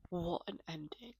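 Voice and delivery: breathy awed whisper. Real, not acted. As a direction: whisper it in awe. Whispered reaction.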